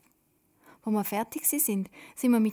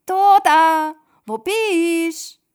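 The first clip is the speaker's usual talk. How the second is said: loud high call into the night; calling his missing sister by the water; 10 semitones higher, 16 dB louder, much slower than usual. A narrator's reading. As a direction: shout her name across the lake. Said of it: "ruft er".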